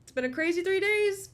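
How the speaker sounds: a viewer exclaiming to Liv in a high, loud gush; excited viewer voice. Pretend. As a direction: play a thrilled viewer reporting back. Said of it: "and tell me".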